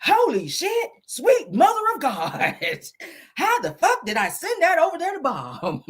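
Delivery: a prim man gasping in horror. Retold or imitated.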